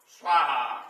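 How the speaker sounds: स्वाहा called out loud like an offering call; loud ritual स्वाहा; emphatic svaha. Shown as a demonstration. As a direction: proclaim स्वाहा forcefully. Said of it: "तो".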